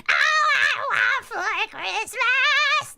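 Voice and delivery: high, slow shouted goblin croak of the [name] song; Toad voice. Acted. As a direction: belt it out as a squeaky toad. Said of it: "As Toad".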